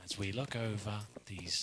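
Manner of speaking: hushed, low documentary narration; nature documentary voice. Pretend. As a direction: narrate it like a wildlife film. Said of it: "nature documentary voice".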